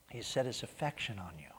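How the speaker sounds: soft whisper of reassurance; whispered assurance. Real, not acted. Pastor whispering God's love to the congregation, hushed. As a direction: breathe it like a secret.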